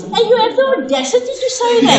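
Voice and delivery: lightly acted scandalized scolding; mock outrage. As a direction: rattle it off as an affronted mother. Mother scolds her in retold quarrel.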